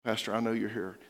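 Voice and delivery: dying man near tears; tearful plea. Retold or imitated. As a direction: speak as a dying man welling up.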